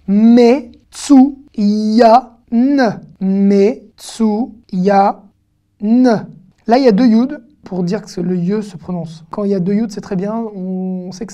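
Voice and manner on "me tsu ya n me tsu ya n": each sound pitched up and held apart; slow, emphatic sounding-out with gaps; syllable by syllable. Shown as a demonstration. Teacher decoding a Hebrew word for learners, syllable by syllable.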